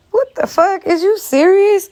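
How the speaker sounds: high, slow, stunned outrage; outraged disbelief. Real, not acted. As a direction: stretch it out in shock. Outraged at the nurses' claim.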